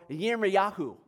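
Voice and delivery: Yirmiyahu said the Hebrew way, starting with a Y; careful Hebrew "Yirmiyahu"; Hebrew pronunciation. Shown as a demonstration. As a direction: say "Yirmiyahu" as yeer-mee-YAH-hoo. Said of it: "Latinized version of Hebrew for".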